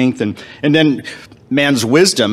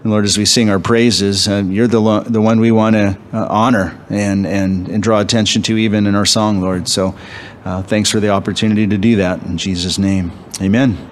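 prayer; 4 semitones lower than usual. Acted.

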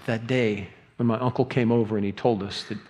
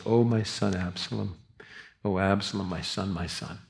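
ordinary talk

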